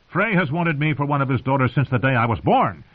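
indignant, insistent argument. A narrator's reading. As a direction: argue it stubbornly, pitch lifted. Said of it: "Edmure insisted".

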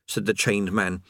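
ordinary delivery

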